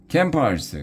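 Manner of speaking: high, sudden shout of alarm; loud cry. A narrator's reading. Modi warning the campers, high alarmed cry. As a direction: call out the campers in a fright. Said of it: "cried Modi".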